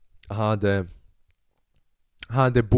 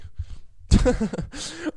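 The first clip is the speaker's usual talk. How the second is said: high-pitched giggle; laugh. Real, not acted. Podcaster tickled by the cashier's line, high giggle.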